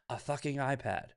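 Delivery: ordinary talk, nothing marked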